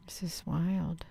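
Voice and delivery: breathy whisper of amazement, sighing first; sigh, then breathe the words out in awe; awed whisper. Real, not acted. Awed aside at the sky.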